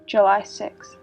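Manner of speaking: ordinary delivery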